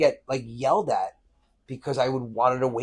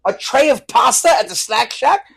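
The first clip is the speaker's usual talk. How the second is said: louder, higher, slowed disbelief; incredulous kid; 10 semitones higher, 9 dB louder than usual. Retold or imitated. A boy scoffing at beachgoers, raised incredulous voice. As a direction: scoff at the silly question.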